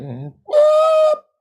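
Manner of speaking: loud, high yelping whoop; mock singer whoop. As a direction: belt a ridiculous note. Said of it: "They try to do like a singer does".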